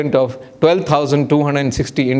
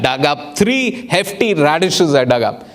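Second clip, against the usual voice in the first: ordinary delivery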